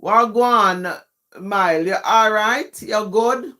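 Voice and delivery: casual patois greeting; Jamaican patois. Shown as a demonstration. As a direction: greet them in Jamaican patois, punching the first word. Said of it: "tell you in patois".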